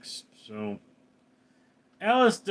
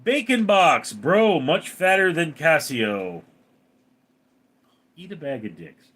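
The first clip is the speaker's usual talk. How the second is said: ordinary delivery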